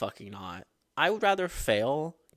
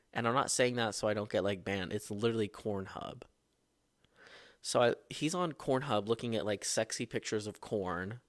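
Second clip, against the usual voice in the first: normal talking voice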